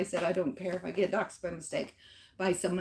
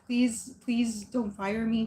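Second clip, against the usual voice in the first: slow, drawn-out re-enacted plea; retold pleading; 2 semitones higher, much slower than usual. Employee begs team leads for her job, retold pleading. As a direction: plead slowly, stretching each please.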